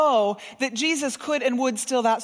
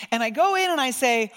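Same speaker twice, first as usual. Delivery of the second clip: normal talking voice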